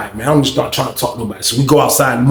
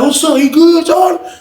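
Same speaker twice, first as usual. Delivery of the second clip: calling out; 13 semitones higher, 4 dB louder than usual. Retold or imitated. Friend calls out across the pod.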